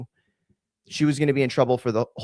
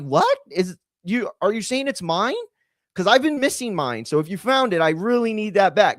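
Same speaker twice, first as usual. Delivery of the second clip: high-pitched, incredulous comeback; mock astonishment; 7 semitones higher than usual. Son-in-law joking back at the meth pipe accusation, mock incredulous. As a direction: play it high, as if truly baffled.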